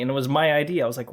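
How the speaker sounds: ordinary talk, nothing marked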